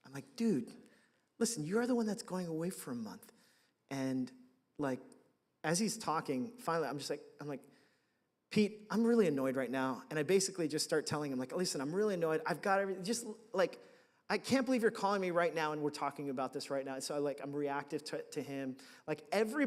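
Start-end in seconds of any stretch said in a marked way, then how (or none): 0.4-3.3 retold annoyance. Snapping at his mentor on phone. 8.5-10.0 retold annoyance. Telling mentor he's annoyed. 11.5-16.9 retold rant. Ranting at mentor on phone.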